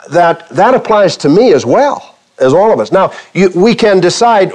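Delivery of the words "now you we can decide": usual speaking voice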